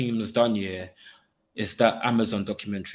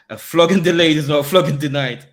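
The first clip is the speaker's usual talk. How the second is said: loud, raised-pitch proclamation; emphatic warning; 4 semitones higher, 9 dB louder than usual. Pretend. Fan warning rivals with his mum's saying, loud and emphatic. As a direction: hammer it out as a mock threat.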